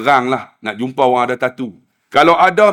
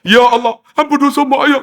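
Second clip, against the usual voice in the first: high, louder cry to God; hammed-up high-pitched supplication; wailing plea; 9 semitones higher, 5 dB louder than usual. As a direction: imitate desperate praying. Acts man's tearful loud prayer.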